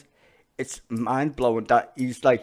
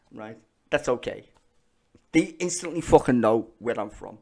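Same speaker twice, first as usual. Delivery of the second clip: ordinary delivery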